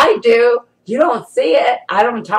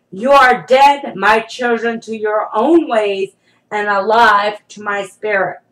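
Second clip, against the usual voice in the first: forceful, deliberate voice of the Lord; prophetic declaration; 3 semitones higher, 4 dB louder than usual. Acted. Speaking as the Lord to God's children, forceful prophecy. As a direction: proclaim it solemnly, leaning on key words.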